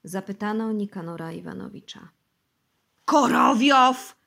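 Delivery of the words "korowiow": drawn-out, loud, high yell of the name. Acted. Bosy yells the culprit's name at the interrogators. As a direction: bellow the name slowly in terror. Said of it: "wrzasnął Bosy".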